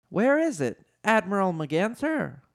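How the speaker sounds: high-pitched questioning voice; high, querying character voice; Leia. A narrator's reading. Princess Leia questions the admiral.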